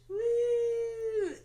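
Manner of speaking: high, loud "woo"; celebratory whoop. Real, not acted. Guest celebrating she didn't cry as much, loud whoop. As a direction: let out a big cheer. Cheering at not crying much.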